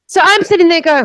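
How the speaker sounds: ordinary delivery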